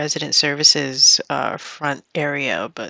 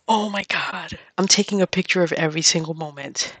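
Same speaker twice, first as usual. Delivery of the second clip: normal talking voice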